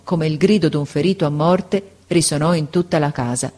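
usual speaking voice

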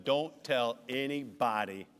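slow, low, measured command; strict charge. Retold or imitated. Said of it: "he strictly charged them".

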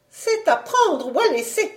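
high-pitched, unyielding wife; curt ultimatum. Acted. Wife gives her husband an ultimatum, high and unyielding. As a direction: lay down terms in a high voice.

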